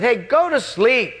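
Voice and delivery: high, exasperated retort; irritated yell back. Acted. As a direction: yell back at someone keeping you up. Annoyed reply to late-night caller.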